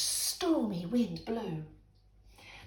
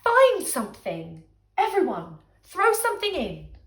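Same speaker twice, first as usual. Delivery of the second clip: louder, grinning call to everyone; rally them gleefully; cheerful call; 3 semitones higher, 7 dB louder than usual. Acted. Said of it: "said with a grin".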